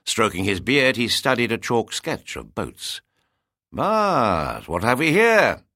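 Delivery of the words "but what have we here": slow, wondering question; surprised. Acted. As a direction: sound pleasantly taken aback. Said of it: "he asked, surprised".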